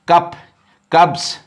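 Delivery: कप then कप्स with a clear s at the end; singular then plural. Shown as a demonstration. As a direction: say कप, then कप्स ending in a crisp s sound. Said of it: "ये देखिए".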